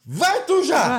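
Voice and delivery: loud high mock yell pushing the wife forward; mock coward yell. Joking dad shoves his wife toward the danger, loud panicked yell. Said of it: "mock coward dad".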